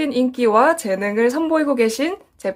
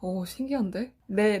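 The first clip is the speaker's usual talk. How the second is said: ordinary delivery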